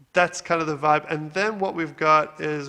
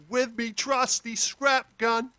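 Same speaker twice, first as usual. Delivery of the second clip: slow, high Australian character voice, unprocessed; put-on Australian accent; 6 semitones higher, slower than usual. Playing the vocal by itself, slow high Australian accent. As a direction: raw Australian-accented take, pitched up high. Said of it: "what it sounds like by itself".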